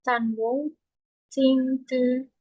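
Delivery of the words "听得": ordinary talk, nothing marked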